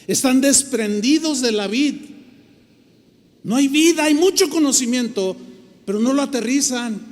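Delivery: emphatic preaching. Shown as a demonstration. Preacher demonstrating the rebuke to theologians, emphatic and loud. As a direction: hammer each phrase home. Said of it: "con este énfasis no se los dije".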